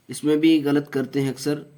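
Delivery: normal talking voice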